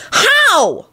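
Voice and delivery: very high plaintive cry; desperate wail. A narrator's reading. Timothy begging Lupin for an answer, desperate. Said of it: "gave Lupin a desperate look".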